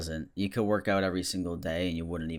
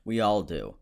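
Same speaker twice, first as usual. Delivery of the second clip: ordinary talk, nothing marked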